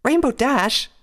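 high, loud cry of a name; shocked reproach. A narrator's reading. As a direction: call her out in shock. Shocked friend begins to object.

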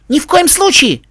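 put-on high, loud childish protest; boy's exclamation. A narrator's reading. Tim objects to turning right, high boyish exclamation. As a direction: cry out like an insistent little boy. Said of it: "воскликнул Тим".

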